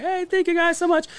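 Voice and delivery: high mock girly thank-you; imitating the girl. Last year's winner thanking the crowd, high mock voice. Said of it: "she's like".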